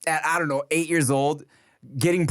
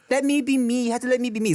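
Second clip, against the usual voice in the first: put-on higher, insistent little girl voice; a small child demanding her way; toddler voice; 8 semitones higher, faster than usual. Dad imitating his toddler insisting let me be me.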